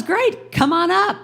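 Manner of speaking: high, bright hostess welcome with a laugh; Carol Burnett. Retold or imitated. Carol Burnett inviting the kid on stage, high laughing welcome. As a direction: beam it like a TV host inviting a fan up. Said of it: "And she goes".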